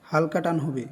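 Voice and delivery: ordinary talk, nothing marked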